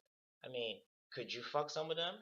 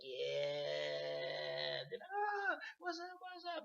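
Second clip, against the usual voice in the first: much higher hype call-out; hyped club greeting; 6 semitones higher, much slower than usual. Retold or imitated. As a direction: hype her up like it's a party. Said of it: "You just having fun in the club".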